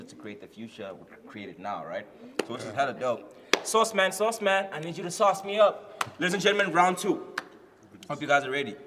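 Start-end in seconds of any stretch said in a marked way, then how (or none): 3.6-7.3 loud, high hype call; hype announcer. Acted. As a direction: pump up the room. Hype host announces round.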